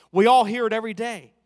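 loud preaching emphasis; preached. Acted. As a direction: drive it home to the church. Preacher presses point to congregation.

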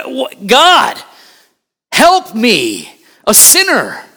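high, loud, urgent pulpit delivery; full-force preaching. Real, not acted. Preacher urging church to look up to God, impassioned preaching. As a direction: build to a high, urgent cry.

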